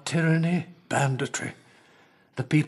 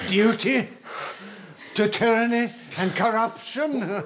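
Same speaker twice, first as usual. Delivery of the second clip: higher, incredulous scorn; scornful retort; 7 semitones higher than usual. Acted. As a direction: throw the word back in disbelief.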